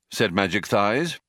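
usual speaking voice

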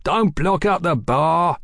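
a mild hammed-up cry across the bar; mock barman cry. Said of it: "cried the barman".

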